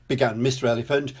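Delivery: ordinary delivery, nothing marked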